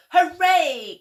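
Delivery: high, loud happy cheer. A narrator's reading. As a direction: throw out a big joyful hooray. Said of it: "cried the scarecrows".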